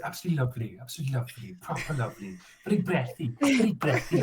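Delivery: soft, breathy North Welsh accent; North Wales accent. Shown as a demonstration. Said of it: "in the north of Wales".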